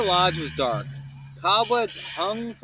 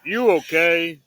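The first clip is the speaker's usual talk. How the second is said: friend voice; 7 dB louder than usual. Acted. Third ghost asks.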